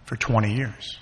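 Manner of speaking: ordinary delivery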